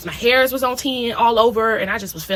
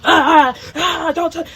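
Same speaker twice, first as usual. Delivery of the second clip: high mock screams, louder; screaming; 7 semitones higher, 4 dB louder, slower than usual. Reliving the dream, she mock screams at her mom. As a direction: act out thrashing in bed.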